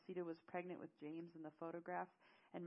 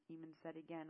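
usual speaking voice

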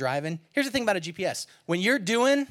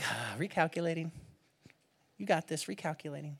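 calm reassuring God; 9 dB quieter than usual. Pretend. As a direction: soothe the lost driver.

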